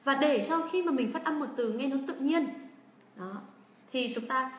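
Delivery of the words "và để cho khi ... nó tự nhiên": normal talking voice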